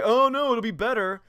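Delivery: high loud mock reassurance; mock fans. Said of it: "everyone's like".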